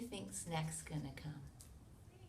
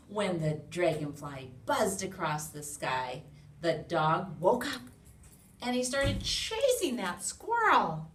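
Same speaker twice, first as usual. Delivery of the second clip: put-on reading to children; 2 semitones higher, 9 dB louder than usual. A narrator's reading. Reading book aloud to children.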